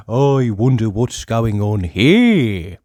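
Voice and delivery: drawn-out curious musing; curious Mister Nosey. A narrator's reading.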